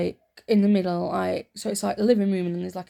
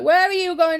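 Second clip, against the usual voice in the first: higher, louder mock mum; mum's sharp question; 10 semitones higher, 7 dB louder than usual. Mum catching her child out of bed, mock sharp. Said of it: "She's like".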